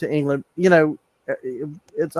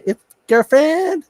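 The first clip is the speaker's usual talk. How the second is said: put-on California accent; 11 semitones higher, slower than usual. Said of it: "You almost sound like California".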